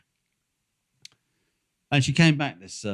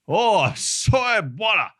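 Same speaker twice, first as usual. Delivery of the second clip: a hammed-up African accent, much higher and louder, slow; bad African accent; 12 semitones higher, 9 dB louder, slower than usual. Airline desk woman refusing the traveler, in a bad African accent. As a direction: put on a clumsy African accent, drawn out. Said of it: "that was my bad African".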